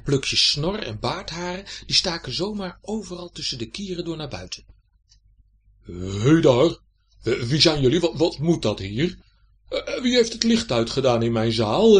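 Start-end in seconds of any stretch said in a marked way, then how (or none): 5.8-12.0 loud, stammering challenge; indignant old knight. A narrator's reading. Man in armour confronts two children, loud blustering demand. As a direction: demand answers, tripping over your words.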